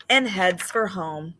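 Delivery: slow, expressive read-aloud with long pauses; reading to children. A narrator's reading. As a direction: read it gently to a class of kids. Teacher reads picture book aloud.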